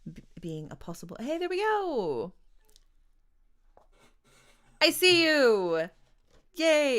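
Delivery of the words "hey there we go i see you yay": loud, joyful "there we go!"; excited cheer. Real, not acted. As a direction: greet them with real delight. Connection back, cheering the guest.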